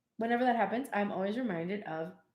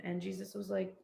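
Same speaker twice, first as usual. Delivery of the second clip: ordinary talk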